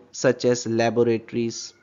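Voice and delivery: ordinary talk, nothing marked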